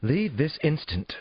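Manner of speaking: high, slow, imperious dismissal; indignant order. A narrator's reading. Therapist orders detective out of the apartment, indignant command. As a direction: order them out, stretching the words.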